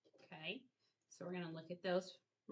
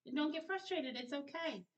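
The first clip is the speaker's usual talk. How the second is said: reassuring; 8 semitones higher than usual. Real, not acted. Tutor calms frustrated child.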